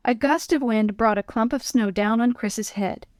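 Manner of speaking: usual speaking voice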